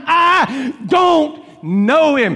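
slow, drawn-out shouted denial. Retold or imitated. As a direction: bellow each word slowly. Peter's third denial, voiced by the preacher.

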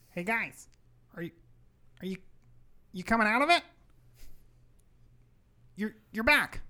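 familiar voice building from halting to loud. Acted. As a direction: start tentative, build to a high, loud call. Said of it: "as it gets louder and louder".

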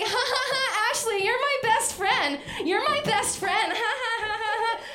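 put-on high, loud, giggling teenage girls wrestling for attention; mock giggly teen girls. Teen girls showing off near a celebrity, in a high giggling mock voice. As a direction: shriek it like silly teenage girls playing for attention. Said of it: "They're just like".

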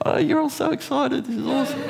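high, teasing mock delight at the crowd; ribbing the silent congregation in a high mock-thrilled voice; mock enthusiasm. Real, not acted. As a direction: tease them with fake excitement.

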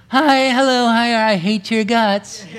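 sugary high greeting that sours into a mock sneer; hypocritical greeting. Preacher mocking a two-faced greeter for the congregation, fake cheer. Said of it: "we've all encountered even the hypocritical greeting".

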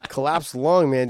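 normal talking voice

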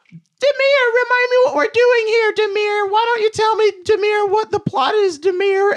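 very high, loud squeaky voice. Retold or imitated. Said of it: "where they're like".

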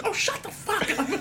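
very high mock screech, half laughing; hammed-up squeal of exasperation; explosion of anger. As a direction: imitate a sister blowing up in a sudden fit. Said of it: "that explosion of anger from her".

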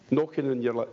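normal talking voice

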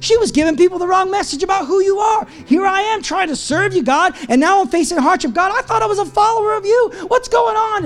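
high-pitched pleading rant at God; complaining, frustrated. Pretend. Preacher imagines complaining to God from prison, frustrated. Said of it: "my complaining and my anger and my frustration".

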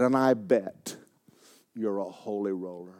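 quiet jab; sneering accusation. Retold or imitated. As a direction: mutter the accusation. Redneck accuses him.

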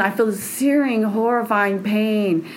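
usual speaking voice

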